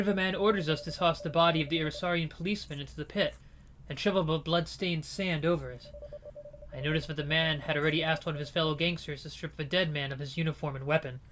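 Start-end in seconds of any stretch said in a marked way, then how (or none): none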